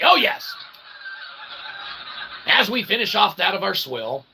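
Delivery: ordinary talk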